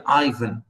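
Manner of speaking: Ivan as EYE-vən, falling away at the end; English pronunciation. Shown as a demonstration. Lecturer repeating the English version, Ivan as EYE-vən. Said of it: "English people, I think they say".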